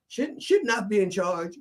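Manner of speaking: high, slow mock complaint; officials objecting. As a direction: drag out the objection. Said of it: "It's like".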